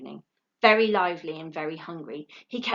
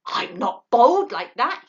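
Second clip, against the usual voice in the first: louder, slow, offended owlet; indignant; 5 semitones higher, 10 dB louder, slower than usual. A narrator's reading. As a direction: take offence. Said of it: "he said indignantly".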